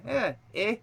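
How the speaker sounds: Canadian-style questioning "eh"; lightly Canadian; Canadian accent. Shown as a demonstration. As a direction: lift it like a Canadian tag. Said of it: "that's more Canadian".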